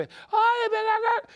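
high, loud mock yell; people yelling. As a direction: shriek it like a neighbor across the house. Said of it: "la gente gritándose".

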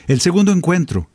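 usual speaking voice

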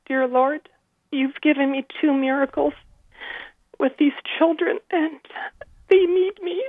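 praying through sobs, barely holding it together; crying. Real, not acted. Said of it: "Sorry, I just get so emotional".

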